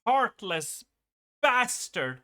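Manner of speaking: high, loud mock betrayal; hammed-up outraged yelling; mock outrage. Mock outrage at game character.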